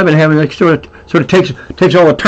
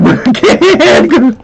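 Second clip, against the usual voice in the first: laughing; 9 semitones higher, much slower than usual. Real, not acted. Laughing at donut joke.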